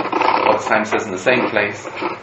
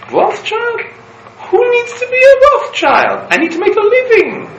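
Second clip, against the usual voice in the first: indignant retort; 7 dB louder than usual. Retold or imitated.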